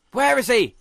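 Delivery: high, loud demand; loud, high exasperated question; frustrated shout. Real, not acted. Frantic search for the monster.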